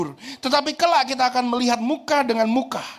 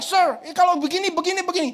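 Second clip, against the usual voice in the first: put-on child questioning; 8 semitones higher than usual. Child voiced asking teacher.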